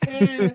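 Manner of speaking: short high squeaky "eh" noise; quick mock squeak; imitated noise. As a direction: squeak out the spider-swat sound. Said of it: "You just get this noise".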